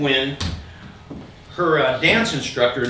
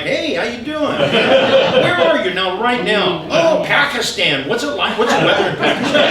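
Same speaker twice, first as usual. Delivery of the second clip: higher, eager and laughing; mock friendly chatter; 6 semitones higher, 8 dB louder than usual. Chatting up a telemarketer, higher and laughing. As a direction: gush at the caller like an old friend. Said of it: "and I'm like".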